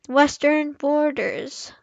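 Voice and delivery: normal talking voice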